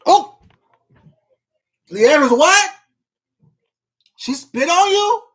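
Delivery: high, loud shocked outburst. Real, not acted. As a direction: gasp out the disbelief. Reacting to shocking chat comment.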